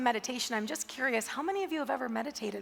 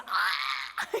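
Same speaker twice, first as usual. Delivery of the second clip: angry groan; 8 semitones lower than usual. Retold or imitated. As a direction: let out a fed-up growl. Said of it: "and he's like".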